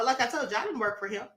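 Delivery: usual speaking voice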